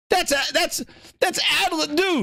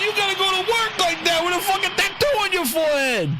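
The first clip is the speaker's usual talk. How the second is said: shocked yelling; 6 semitones higher than usual. Acted. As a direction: yell it out in total disbelief.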